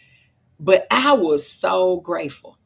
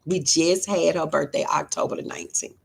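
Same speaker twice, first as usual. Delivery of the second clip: usual speaking voice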